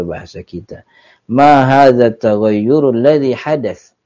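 normal talking voice

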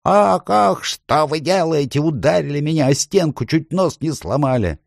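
high-pitched howl of a hurt dwarf; pained, high wailing complaint. A narrator's reading. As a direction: wail indignantly over your sore nose. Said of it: "потирая нос и вопя".

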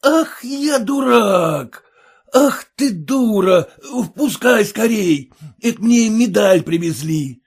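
high, loud and slow, overjoyed cry; excited shout. A narrator's reading. Merchant shouting to the cook to let the courier in. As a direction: burst out in joyful alarm. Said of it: "кричал он".

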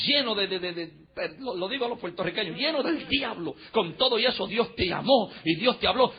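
ordinary talk, nothing marked

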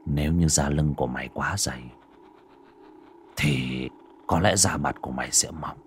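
low, soft, menacing threat. Acted. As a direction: threaten her quietly, savouring it. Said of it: "mà hăm dọa".

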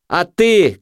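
high yelled retort; shouted. A narrator's reading. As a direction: cry it out at him. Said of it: "крикнув наркоман".

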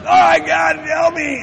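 high, loud mock-desperate cry; desperate outburst. Preacher trying not to lose it laughing, desperate yell. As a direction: burst out pleading, fighting off laughter. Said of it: "trying not to totally, completely lose it".

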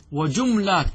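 وجملات as "wa-jum-laat", no vowel after m; vowelless middle consonant. Shown as a demonstration. Teacher lists plural forms to students, وجملات as "wa-jum-laat". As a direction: close the m straight onto the l in وجملات. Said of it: "بالسكون".

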